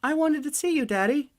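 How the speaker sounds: put-on very high small child's voice; sweet little kid. Daughter telling her father she missed him, high and sweet.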